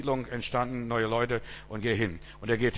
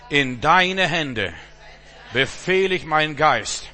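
slow, loud prayer with hands raised, held word by word; loud led prayer; 6 dB louder than usual. Acted. Preacher leading his church in prayer, loud and slow. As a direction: pray it out loud and slowly for everyone to follow.

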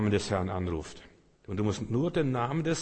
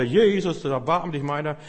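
loud, high plea; louder cry; 4 semitones higher, 5 dB louder than usual. Acted. Blind man of Jericho shouting louder to Jesus. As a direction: call out over the crowd. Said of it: "Der rief noch lauter".

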